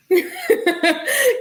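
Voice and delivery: sudden high squeal of laughter. Real, not acted. Streamer cracking up at the boyfriend's reply, high laugh. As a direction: let a high laugh burst out.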